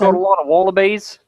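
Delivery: hammed-up Aussie accent; playful Australian accent. Teasing about Australian wildlife, Australian accent. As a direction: toss out Aussie buzzwords in accent. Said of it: "he's saying the buzzwords".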